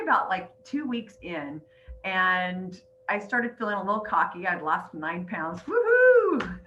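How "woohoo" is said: high, loud, drawn-out cheer; celebratory whoop. Acted. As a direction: whoop it up, a little cocky. Mock celebration of losing nine pounds.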